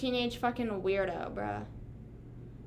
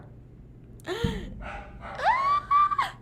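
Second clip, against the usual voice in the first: gasp. Real, not acted. Sudden shocked gasp.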